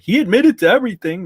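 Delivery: high, loud put-on gotcha voice; mock gotcha critic. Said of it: "so they can be like".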